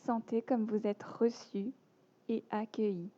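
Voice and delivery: measured yoga nidra voice with long pauses between phrases; guided meditation. Acted. Teacher guiding listeners lying down into yoga nidra, calm meditation voice.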